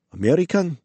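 high, rising one-word question; puzzled surprise. A narrator's reading. Puzzled woman with rifle hears English, surprised question. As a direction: say it in puzzled disbelief.

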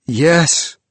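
cried yes. Acted. Ghost crying out to the priest in anguish. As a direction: cry out the answer. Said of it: "cried the vision".

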